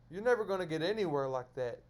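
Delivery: ordinary talk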